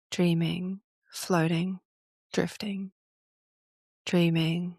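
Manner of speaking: unhurried trance-inducing voice with long pauses; hypnotic induction. Real, not acted. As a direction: lull them toward drifting off, phrase by phrase.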